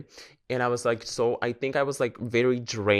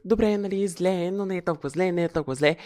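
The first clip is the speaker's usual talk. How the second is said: higher, brushing-it-off self-talk; mock upbeat denial; reassuring herself; 6 semitones higher than usual. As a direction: talk yourself into feeling okay. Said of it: "си виках".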